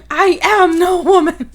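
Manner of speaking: high, loud, heroic proclamation; dramatic declaration. Acted. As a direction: declare it with mock-epic grandeur. Dramatic reveal acted from the story.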